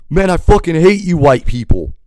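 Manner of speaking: high, loud hateful shout; furious yell. Retold or imitated. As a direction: spit it out, yelling in rage. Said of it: "he goes".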